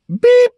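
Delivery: a sharp high loud beep, like a bleep over a swear; mock censor beep. Bleeping his own swearing, a sharp high loud beep. Self-censoring a curse word.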